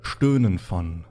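low, hushed dungeon narration; eerie scene narration. Acted. Game master describing the undead approaching, hushed and eerie.

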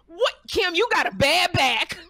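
high, loud disbelief rising sharply on the last question; you can't be serious, sit down; incredulous outburst. Retold or imitated. Telling Kim with a bad back to sit, incredulous high outburst. Scolding injured friend who won't sit.

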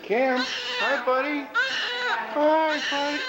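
excited greeting. Real, not acted. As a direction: call his name like you can't wait to meet him. Dad calls to newborn son.

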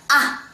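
Ah short, loud, from high straight down; falling fourth tone. Shown as a demonstration. Teacher finishing the tone review, short falling Ah. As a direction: drop Ah sharply as if angry. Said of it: "From first tone to last tone".

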